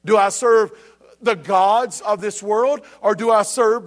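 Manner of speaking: rising, emphatic preacher's cry with slow questions; preaching at full force. Acted. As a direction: press the congregation hard with each question. Builds preaching intensity.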